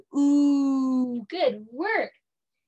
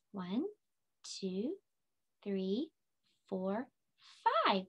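quiet counting; 3 semitones lower, 10 dB quieter, slower than usual. Shown as a demonstration.

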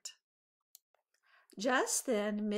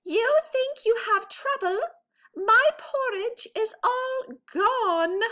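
put-on Baby Bear wailing; 14 semitones higher, 8 dB louder than usual. A narrator's reading. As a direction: wail like a little bear who lost his porridge. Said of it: "Baby Bear wailed".

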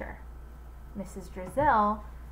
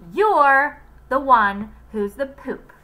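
slow, much louder retort; telling off; 6 semitones higher, 11 dB louder, slower than usual. Acted. Joy retorting to Louis, angry and loud. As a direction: hurl it at him.

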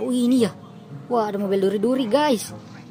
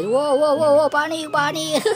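higher, louder wow wow, slowing on panik; excited panic; 6 semitones higher, 3 dB louder, slower than usual. Acted. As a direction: play the panicking cars, all worked up. Cars panic at the siren.

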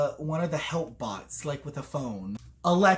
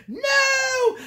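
very high, loud "no"; hammed-up scream of grief; mock anguished cry; 5 dB louder than usual. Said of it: "And she's like".

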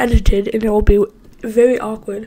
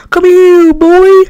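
high, louder coaxing call; louder pet call; 6 semitones higher, 7 dB louder, much slower than usual. Acted. Calling again, louder, put-on.

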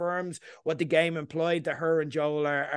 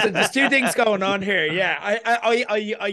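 laughing; 4 semitones higher, 5 dB louder than usual. Real, not acted. Laughs into the line.

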